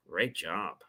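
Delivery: low, soft sarcastic praise. Shown as a demonstration. Coach showing how tonality changes praise, sarcastic. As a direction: say it quietly, without meaning the compliment. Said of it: "just that tonality and the way I said it".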